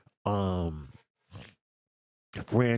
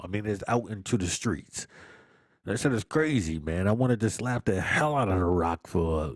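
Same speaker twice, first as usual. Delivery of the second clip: normal talking voice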